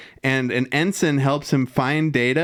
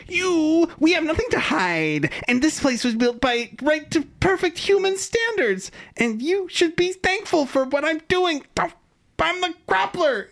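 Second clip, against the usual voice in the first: high, pinched, indignant official voice; much higher, huffy Zorn voice; Groppler Zorn impersonation; 14 semitones higher than usual. Retold or imitated. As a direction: squeal it out like a self-important little boss. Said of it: "And the Groppler is like".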